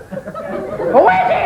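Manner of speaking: very high, slow laughing parrot squawk. Acted. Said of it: "the parrot said".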